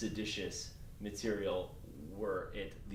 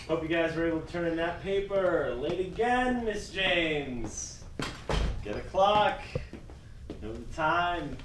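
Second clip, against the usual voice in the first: stern scolding; 7 semitones higher, 5 dB louder than usual. Acted. Teacher scolds late student.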